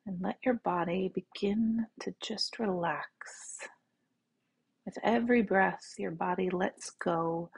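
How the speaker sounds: soft, unhurried meditation voice; guided meditation. Real, not acted. Teacher leading listeners to relax in the right now, soft meditation voice. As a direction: guide them gently into the body, with long pauses.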